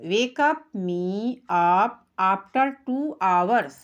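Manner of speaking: each English word drawn out very slowly; slow pronunciation demo. Shown as a demonstration. Teacher showing pupils the pronunciation, very slow. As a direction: model every word slowly and clearly. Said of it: "यहाँ नीचे उच्चारण देखो".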